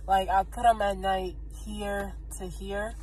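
speaking with lip held open; finger pulling lip down. Real, not acted. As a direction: finger at mouth corner. Seen 3: fingertip holds lower lip down, showing braces while speaking.

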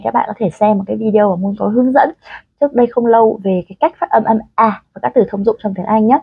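ordinary delivery

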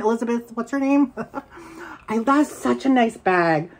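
ordinary delivery

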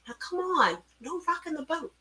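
high, soft plea; hushed high-pitched pleading; urging caution. A narrator's reading. Honey urges doll to behave.

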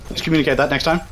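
normal talking voice

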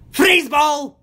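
high, loud battle cry; high-pitched villain shout; villainous yell. A narrator's reading. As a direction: yell it gleefully as you throw. Gargamel throws another, yelling.

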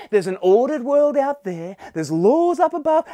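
put-on high, emphatic recap for the children; rousing children's recap. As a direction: lead the class loudly through the list. Leads children in recap of ingredients.

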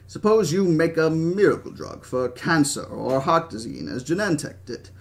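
put-on animated old British speechmaker; Hammond. Old founder lecturing Henry on business, animated British voice. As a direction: lecture and scoff with a British accent.

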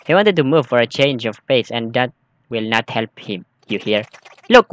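usual speaking voice

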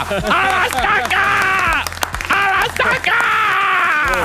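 very high, slow, stretched-out shout; drawn-out mob cry. Acted. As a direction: hold each word like a chanting mob. Mock mob cry repeated slow.

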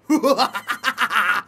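low evil cackle, put-on villain voice; risada do mal. Shown as a demonstration. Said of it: "Eu sei fazer, ó". (risada maligna do vilão)

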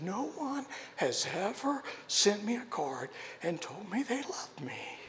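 soft, slow, breaking into a whisper; tearful whisper. Retold or imitated. Tearful woman thanking Joe for his card, whispering. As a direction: let it fall to a tearful whisper. Woman hugging him in tears.

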